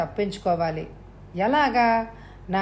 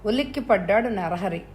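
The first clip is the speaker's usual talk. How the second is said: usual speaking voice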